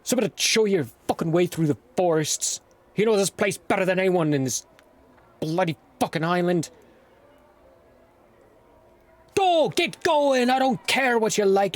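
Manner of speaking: high, fast, cursing dwarf rant; angry old dwarf. Acted. Dwarf scolding the late arrivals, high fast angry rant. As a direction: bark at them like a grumpy old dwarf. Dwarf hands over a scout.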